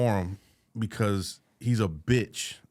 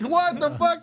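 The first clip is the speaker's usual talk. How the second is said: shocked; 3 dB louder, slower than usual. Retold or imitated. His shocked reaction.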